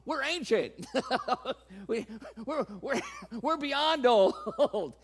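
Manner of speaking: put-on old Sarah giggling, very slow on the last words; laughing as Sarah. Sarah laughing at her own great age. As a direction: laugh through it, drawing out beyond old. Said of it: "She's like".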